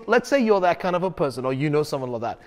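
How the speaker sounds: ordinary talk, nothing marked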